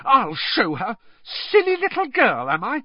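high, indignant outburst; shrill rage. A narrator's reading. Hermione fumes at Rita Skeeter's insult, shrill with rage. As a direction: throw the insult back in outrage. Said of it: "She was shaking with rage".

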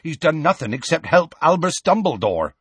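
impatient. A narrator's reading. Hagrid defending Firenze to the centaurs, impatient. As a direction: argue back impatiently. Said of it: "said Hagrid impatiently".

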